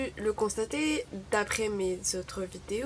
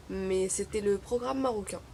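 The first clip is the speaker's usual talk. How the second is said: normal talking voice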